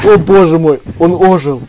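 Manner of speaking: loud playful gasp of shock; mock surprise. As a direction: cry out as if he rose from the dead. Colleague returns after "killed" joke.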